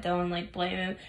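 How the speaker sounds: normal talking voice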